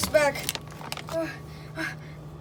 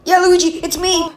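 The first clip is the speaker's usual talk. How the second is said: loud, raised calls; calling out; 5 semitones higher, 11 dB louder than usual. Acted. Mario and Luigi calling through the fridge, raised shouts. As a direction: shout through a closed door.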